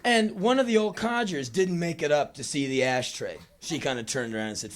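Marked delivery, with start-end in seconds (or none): none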